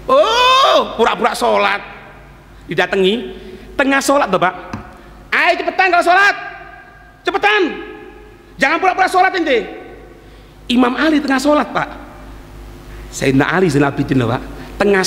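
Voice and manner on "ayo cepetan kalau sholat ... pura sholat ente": higher, pushy mock demand; impatient accuser. Accuser nagging the Imam mid-prayer, impatient and higher. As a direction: hurry him up rudely.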